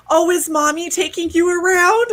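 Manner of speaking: cooing neighbor. Retold or imitated. Neighbor mistaking her for a mom, high cooing. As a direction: coo down at her like she's a kid.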